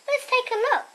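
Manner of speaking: lightly voiced cheerful mum; mum's voice. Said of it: "my mum says".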